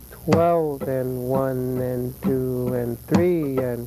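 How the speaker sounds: slow count with "and" between beats; steady, drawn-out counting. Shown as a demonstration. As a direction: count slowly through the cycle over the guitar. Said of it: "you start with a golpe".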